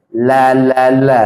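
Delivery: la la la slow, tongue spread wide. Shown as a demonstration. Teacher drilling the broad lam. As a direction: stretch each la with a broad tongue. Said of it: "Jangan la la la".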